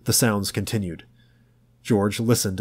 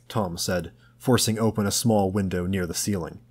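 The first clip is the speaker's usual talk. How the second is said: plain reading voice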